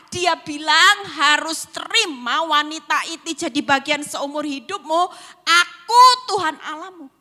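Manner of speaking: loud, high commanding divine voice; voice of God. Retold or imitated. God rebuking the weeping wife, loud and commanding. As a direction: proclaim it as God rebuking her tears. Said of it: "Tuhan cuman bilang gini".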